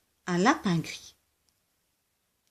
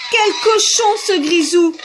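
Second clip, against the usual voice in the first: higher, louder angry rant; angry rabbit; 7 semitones higher, 6 dB louder than usual. Acted. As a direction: rage at the messy neighbour. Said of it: "Le lapin brun se fâcha". (lapin fâché qui crie, plus aigu et plus fort)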